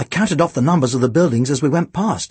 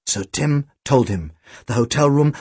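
ordinary delivery, nothing marked

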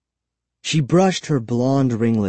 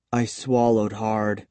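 usual speaking voice

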